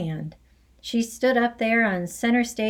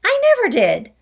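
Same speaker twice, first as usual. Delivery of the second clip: loud, much higher shocked cry; boy crying out in protest; exclaimed; 13 semitones higher, 8 dB louder, faster than usual. Acted. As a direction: blurt it out in alarm. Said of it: "the boy exclaimed".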